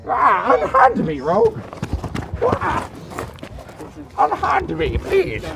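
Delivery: high, breathless pleading yelps; fluttering cries for help. Acted. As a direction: squeal for help like a fop in distress. Tackled character cries out.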